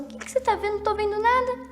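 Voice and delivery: a hint of a confused grown-up asking; puzzled leader quote. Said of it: "um líder chegou pra mim e falou assim".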